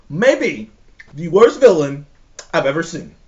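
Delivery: usual speaking voice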